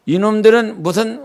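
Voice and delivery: ordinary talk, nothing marked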